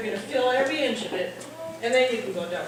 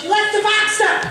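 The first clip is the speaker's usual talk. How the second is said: higher, louder accusing shout; retold yell; 9 semitones higher, 6 dB louder than usual. Husband yelling that she left the truck box up, accusing shout. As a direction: yell the mistake at her. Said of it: "and he goes".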